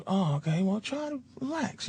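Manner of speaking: soft, lightly voiced older man; quiet, hushed advice; a gentle hint of imitation, very soft; William Shatner. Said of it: "And he goes".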